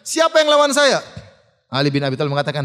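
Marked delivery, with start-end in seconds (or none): 0.0-1.0 high-pitched taunting call; shouted challenge. Retold or imitated. Amr calls for an opponent as none step forward, shouting. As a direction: cry out the dare to the whole crowd. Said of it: "Dia teriak lagi".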